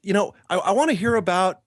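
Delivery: hammed-up delighted acquaintance; gushing old friend. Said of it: "this guy goes".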